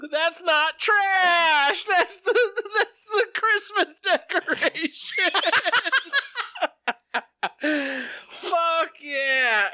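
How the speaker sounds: high laughing shouts; excited yelling. Real, not acted. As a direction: whoop and laugh wildly. Friend pukes in decorations.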